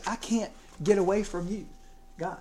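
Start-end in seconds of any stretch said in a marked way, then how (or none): none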